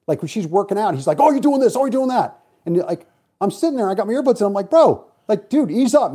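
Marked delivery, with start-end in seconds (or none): 1.2-2.4 high-pitched, nagging imitation; mock berating. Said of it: "he's just bashing his chick". 4.7-6.1 louder, raised exasperated appeal; retold telling-off. Retells confronting the young kid.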